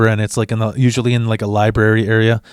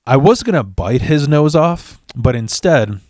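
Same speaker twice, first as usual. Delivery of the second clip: ordinary delivery, nothing marked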